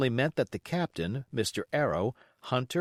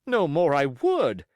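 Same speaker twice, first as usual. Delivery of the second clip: louder indignant cry; cried out; 4 semitones higher, 6 dB louder than usual. Acted. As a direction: squire bursts out. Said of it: "cried the squire".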